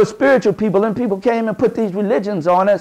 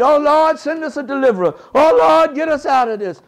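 pleading prayer; 6 semitones higher than usual. Retold or imitated. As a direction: call out to God for a deliverer. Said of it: "We prayed".

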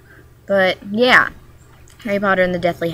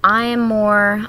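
normal talking voice

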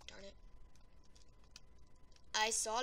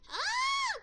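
startled shriek; 8 dB louder than usual. Real, not acted. Startled in game.

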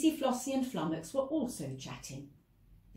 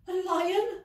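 trembling; 10 semitones higher, 4 dB louder than usual. Acted. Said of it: "trembled Nat".

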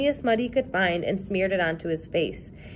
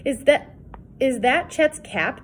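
ordinary delivery, nothing marked

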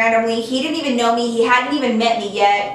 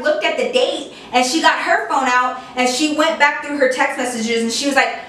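normal talking voice

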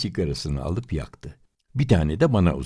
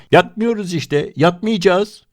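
much higher, louder panicked yell; frantic shout; 11 semitones higher, 6 dB louder than usual. A narrator's reading. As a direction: shout it like you're losing it. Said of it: "Deli gibi bağırdım". (deli gibi bağırarak, çok yüksek sesle)

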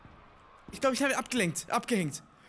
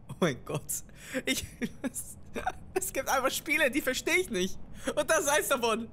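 usual speaking voice

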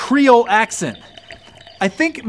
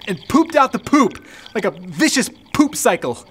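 ordinary talk

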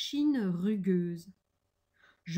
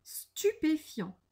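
slow, higher word of amazement; surprised; 8 semitones higher, slower than usual. A narrator's reading. The gruffalo answers the mouse, slowly amazed. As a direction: draw it out, mildly astonished.

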